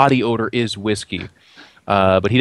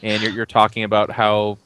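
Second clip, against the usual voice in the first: ordinary delivery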